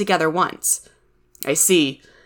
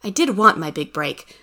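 ordinary delivery

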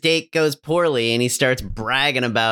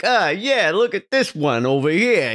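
mock macho guy; 4 semitones higher than usual. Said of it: "And he's still like".

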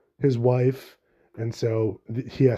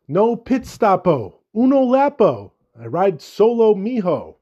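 higher, louder cocky race car swagger; Lightning McQueen quote; 7 semitones higher, 5 dB louder, slower than usual. Retold or imitated. Host quoting Lightning McQueen for listeners, loud cocky swagger. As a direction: belt it out like a brash cartoon racer. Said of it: "To quote, uh, Lightning McQueen".

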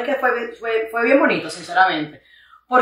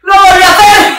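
grito de risa; 8 semitones higher, 8 dB louder than usual. Retold or imitated. Grita contando su reacción.

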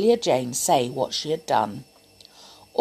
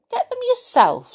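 very high, cheeky defiant retort; naughty doll refusing the toys; rude refusal; 3 dB louder than usual. Acted. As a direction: throw it back defiantly. Amelia refusing rudely.

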